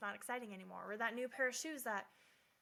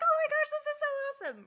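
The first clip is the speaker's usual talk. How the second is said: much higher, louder squeal of delight; excited; 12 dB louder, much faster than usual. Retold or imitated. Imitating someone thrilled by new things, excited squeal. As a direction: gush like a kid with new shoes.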